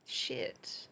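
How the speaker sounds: soft whispered swear under the breath; whispered curse. Real, not acted. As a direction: mutter it to yourself, caught out.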